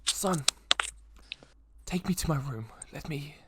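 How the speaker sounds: faint voice fading to a whisper; weakened old mayor. Acted. Weakened mayor asking his son for help, frail and hushed. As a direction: let the strength drain into a breathy whisper. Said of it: "he seems a lot weaker".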